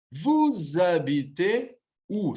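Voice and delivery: "Vous habitez où" drawn out slowly; slow model pronunciation. Shown as a demonstration. Teacher showing another form of the question, slow and deliberate. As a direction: say "Vous habitez où" at half speed, syllable by syllable.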